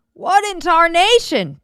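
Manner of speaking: loud startled shout, pitched high; Pa screams. Acted. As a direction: yell it out in shock at the blazing field. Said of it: "Pa screamed".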